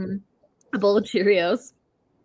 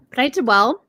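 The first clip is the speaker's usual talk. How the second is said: ordinary talk, nothing marked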